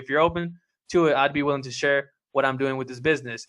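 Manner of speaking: fast run-through of the same line; quick pitch recap. Shown as a demonstration. Coach repeating his pitch quickly to sum up. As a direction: rattle the offer off briskly.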